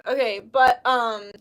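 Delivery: usual speaking voice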